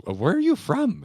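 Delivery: high, bright mock attendant voice; imitating the toll attendant. Retelling the toll booth chat, a light imitation of the attendant asking. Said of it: "He just goes".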